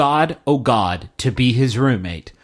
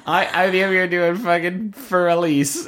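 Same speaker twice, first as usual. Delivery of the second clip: ordinary delivery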